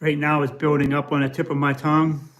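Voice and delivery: ordinary talk, nothing marked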